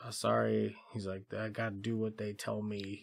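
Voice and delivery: put-on low, soft childlike apology; sheepish dim-witted voice; simple-minded voice. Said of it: "he's real simple-minded".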